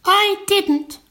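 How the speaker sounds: very high March Hare protest; hurried interruption. Acted. March Hare interrupting the Hatter, high hasty protest. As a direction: cut in with a high squeal of denial. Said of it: "interrupted in a great hurry".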